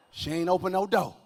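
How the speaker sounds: ordinary talk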